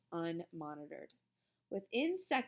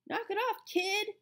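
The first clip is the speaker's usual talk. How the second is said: high, raised-voice rebuke; annoyed command; 11 semitones higher, 5 dB louder than usual. A narrator's reading. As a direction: tell him off, pitched high. Calvin tells Melvin to stop.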